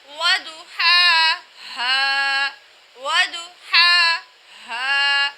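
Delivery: slow وَدُحَاهَا, first HA pharyngeal, second glottal; two distinct ha sounds. Shown as a demonstration. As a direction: draw out both ha's in وَدُحَاهَا, tight then open. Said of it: "ہم نے ہا اور ہا میں واضح فرق کیا". (وَدُحَاهَا میں دونوں ہا کھینچ کر الگ الگ)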